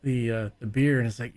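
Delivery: ordinary delivery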